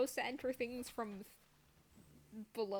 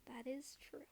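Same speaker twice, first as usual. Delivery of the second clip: whisper; 3 semitones higher, 8 dB quieter than usual. Pretend. Hushed agreeing aside.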